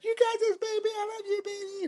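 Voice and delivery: very high falsetto cheerleading; squeaky, hammed-up reassurance; encouraging girlfriend. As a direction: coo support in a mock girlfriend voice. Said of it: "And the other one's like".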